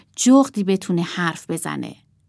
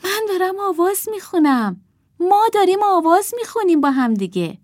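put-on girl puppet voice; 11 semitones higher, 4 dB louder than usual. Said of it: "دخترک با خودش گفت".

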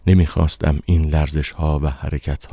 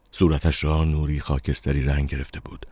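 ordinary delivery

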